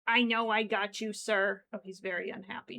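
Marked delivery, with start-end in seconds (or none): none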